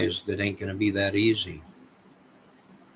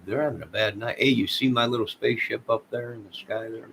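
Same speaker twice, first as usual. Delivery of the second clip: ordinary talk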